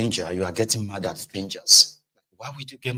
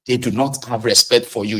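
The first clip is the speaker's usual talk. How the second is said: normal talking voice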